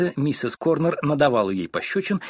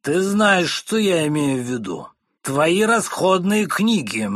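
higher and louder, slow at the end; shouting husband; 6 semitones higher, 5 dB louder, slower than usual. Acted. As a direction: yell the demand across the table. (громкий окрик мужа на жену)

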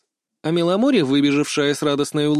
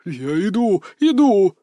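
higher, louder on the repeat; hasty surrender; 6 semitones higher, 5 dB louder, slower than usual. A narrator's reading. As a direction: cry out in alarmed compliance. Cat hastily gives in to threat. (испуганно соглашаясь)

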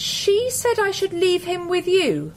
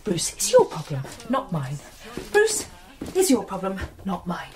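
low, measured repetition; self-steeling mantra; 3 dB quieter than usual. Acted. Telling herself the cat isn't hers, low and firm. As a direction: chant it quietly to steel yourself.

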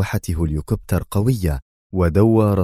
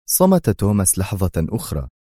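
usual speaking voice

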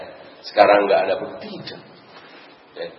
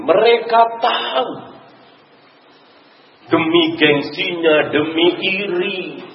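forceful preaching; 9 semitones higher, slower than usual. Real, not acted. Preacher denouncing hidden truth.

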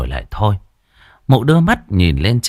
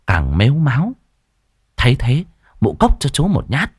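ordinary delivery

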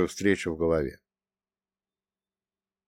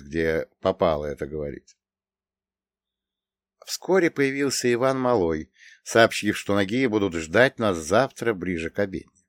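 usual speaking voice

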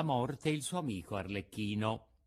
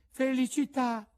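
Pinocchio's voice; 14 semitones higher, 5 dB louder than usual. A narrator's reading. Pinocchio blesses the sneeze.

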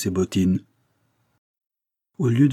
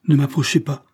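sharp, higher and louder warning to keep away; mise en garde; 7 semitones higher, 6 dB louder than usual. Acted. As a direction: push him back with a raised, louder voice. Elle repousse son amant. (mise en garde vive, voix haute et forte)

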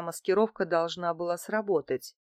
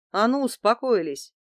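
a louder, sharp order to calm down; a woman shouting at two men fighting; stern shout; 5 semitones higher, 5 dB louder than usual. A narrator's reading. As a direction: snap them into silence. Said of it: "прикрикнула я".

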